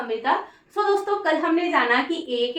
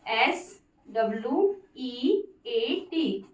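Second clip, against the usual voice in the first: teacher spelling एस W ई ए टी; slow spelling; 2 semitones lower, 5 dB quieter, much slower than usual. Shown as a demonstration. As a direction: spell एस W ई ए टी very slowly and softer. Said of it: "sweat".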